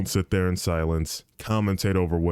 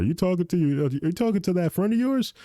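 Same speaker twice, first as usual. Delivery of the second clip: high-pitched imitation of a puzzled dad; questioning dad; 10 semitones higher than usual. Dad asking who his son talks to, high imitated questioning. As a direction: put on dad's voice, pitched well up. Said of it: "he's like".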